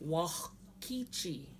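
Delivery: slow "Wakichi", throaty rasp closing the "Wa"; guttural X. Shown as a demonstration. Teacher showing that X sound, slow "Wakichi" with guttural rasp after "Wa". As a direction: stretch "Wakichi", scraping the throat before "ki". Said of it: "So there's that X sound".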